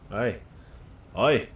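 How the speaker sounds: a lightly faked Irish "Aye"; a bad pretend Irish accent on "Aye". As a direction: say "Aye" with a put-on Irish lilt. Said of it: "Now I'm Irish".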